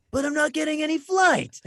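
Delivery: high, drawn-out mock calculating voice; acted young thinker, stretched and high; puzzled boy working it out. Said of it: "the equation was like".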